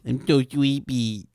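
put-on slow sleepy baby-voice lisp; whiny little kid voice; cartoon baby talk. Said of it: "Why are you talking like that?".

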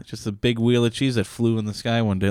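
ordinary delivery

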